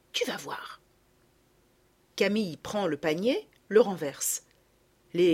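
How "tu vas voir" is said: secretive whisper. A narrator's reading. Girl hinting at her plan, whispered. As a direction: keep it a little secret. Girl teasing about the hedgehogs.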